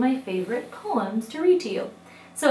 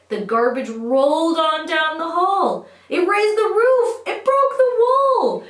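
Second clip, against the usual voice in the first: rising higher and louder to a big peak; dramatic build; 10 semitones higher, 8 dB louder, slower than usual. A narrator's reading. Reader telling children the garbage breaks the house, dramatic crescendo. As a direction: build to an over-the-top climax. Poem's garbage pile climaxes.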